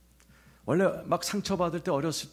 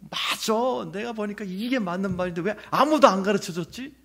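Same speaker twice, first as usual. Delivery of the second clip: excited realization; 7 semitones higher, 5 dB louder than usual. Retold or imitated. Inner eureka moment recalled.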